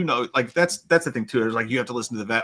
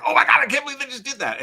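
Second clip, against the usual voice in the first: high, louder mock disbelief; shocked viewer; 11 semitones higher, 5 dB louder, faster than usual. Viewer reacting to a shocking comedy, mock shock. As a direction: exclaim it like a stunned moviegoer.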